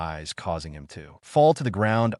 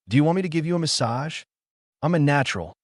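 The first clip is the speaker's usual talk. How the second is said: quick, high, eager offer; 6 semitones higher, faster than usual. A narrator's reading. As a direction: pitch it up, rush it eagerly. Naruto eagerly offers a massage.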